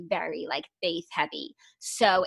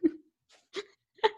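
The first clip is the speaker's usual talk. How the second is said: laughing; 7 semitones higher, 4 dB louder than usual. Real, not acted. Laughing at friend's story.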